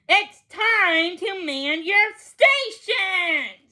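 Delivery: rally the troops; calling out. Acted. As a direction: cook calling the scouts to their stations. Danny giving orders.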